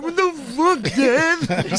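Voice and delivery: very high, mock-wailed protest; hammed-up high cry of outrage; retold outraged yelp. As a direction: squeal it like a kid who just got hit. Retold outburst at his dad.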